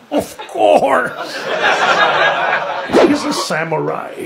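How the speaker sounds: a touch of mock sudden insight; mock realization. Airline announcer mocked, light put-on voice with sudden realization. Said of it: "he was like".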